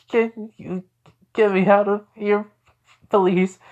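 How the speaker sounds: high pleading character voice; Konohamaru pleading. Acted. Boy stuck in ice asks for help, high plea. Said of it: "as you come, Konohamaru saying".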